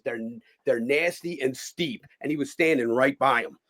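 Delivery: ordinary delivery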